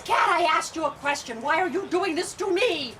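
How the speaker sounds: high, loud scolding; exasperated demand for an answer; mad witch. Acted. Witch questioning her silent cat, mad and exasperated. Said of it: "she was really getting mad".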